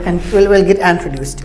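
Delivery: ordinary delivery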